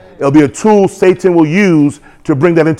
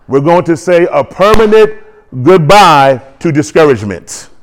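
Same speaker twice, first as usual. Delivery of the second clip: ordinary talk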